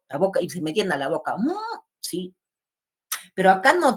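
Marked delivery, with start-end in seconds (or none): none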